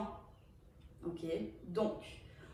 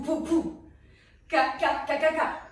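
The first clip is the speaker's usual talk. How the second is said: vocal beat; 4 semitones higher, 5 dB louder than usual. Shown as a demonstration. Dance teacher voicing the beat.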